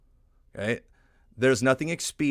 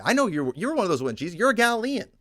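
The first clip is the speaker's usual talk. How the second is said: high, quick accusation; accusing; 8 semitones higher, faster than usual. Acted. Bystander exposing Peter as a Galilean, accusing. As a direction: point him out as if you've caught him.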